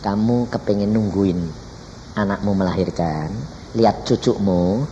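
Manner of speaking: measured low divine voice; voice of God. Retold or imitated. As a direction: speak as God, deep and unhurried. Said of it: "Tuhan bilang".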